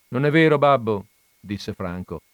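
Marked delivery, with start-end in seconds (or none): 0.1-1.1 protesting. A narrator's reading. Boy protests to his father.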